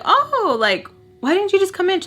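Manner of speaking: very high, sugary put-on politeness; fake sweetness. Welcoming Miss Peach at the door, high fake-sweet voice. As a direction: greet her with overdone fake friendliness. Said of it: "And I tell her".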